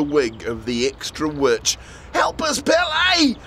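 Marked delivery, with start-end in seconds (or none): 2.1-3.4 high, slow call for help; crying out. A narrator's reading. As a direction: shout for help while pulling. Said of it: "they cried".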